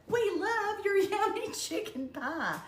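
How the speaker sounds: high, slow, laughing imitation of parade fans calling out; mock crowd cheer. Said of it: "people would start yelling".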